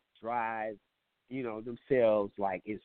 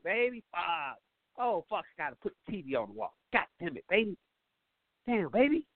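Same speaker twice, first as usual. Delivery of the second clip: higher whiny complaining man; mock grumbling boyfriend; 10 semitones higher than usual. Man whines to his girl about fixing the shower. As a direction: grumble like a put-upon boyfriend.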